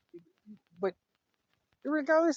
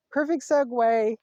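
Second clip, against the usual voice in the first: loud, higher and drawn out; big, slow, excited retold reaction; delighted; 6 semitones higher, 11 dB louder, slower than usual. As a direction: seize the opening with glee.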